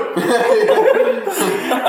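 high, amused burst of laughter; laughing. Real, not acted. Storyteller cracking up over friends' drunken fight, laughing.

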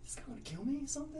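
hammed-up fright, high and fast; mock panic. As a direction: rattle it off in jokey fear.

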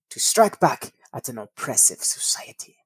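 weak, half-hearted German accent. Shown as a demonstration. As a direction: try a German accent, badly. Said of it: "Should I do that in the German voice?".

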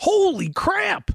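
high, drawn-out exclamation of disbelief; astonished. Real, not acted. Interviewer hears the sum stolen was six thousand dollars, astonished. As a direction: stretch it out high in amazement.

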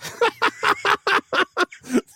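sudden high, far laugh; high burst of laughter. Real, not acted. Laughing at the cartoon pants story. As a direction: crack up laughing at the image.

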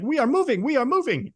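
high, excited mock announcement; high put-on exclamation, repeated; Eddie Murphy impersonation. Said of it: "Eddie Murphy's like".

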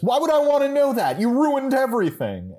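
high, loud indignant outburst; Millicent. Acted. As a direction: yell it as a wounded, furious accusation. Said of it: "And Millicent says".

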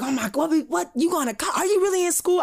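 high, incredulous dad voice; imitating a pissed-off dad; angry dad. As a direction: play the outraged father, pitch climbing.

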